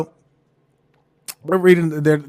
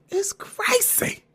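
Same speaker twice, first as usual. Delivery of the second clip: breathy whispered aside; 5 dB quieter, slower than usual. Retold or imitated. Muttering to herself at mom's house, whispered. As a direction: whisper it in disbelief.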